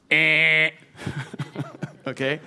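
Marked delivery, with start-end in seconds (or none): 0.1-0.7 loud imitated game-show buzzer; wrong-answer buzzer. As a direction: blare a buzzer noise. Buzzer rejecting a failing apology.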